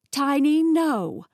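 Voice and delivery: high, loud shout at a dog; huffy scolding. A narrator's reading. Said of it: "Millie huffed".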